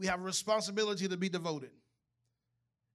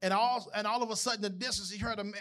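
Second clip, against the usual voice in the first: ordinary talk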